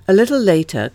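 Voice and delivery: usual speaking voice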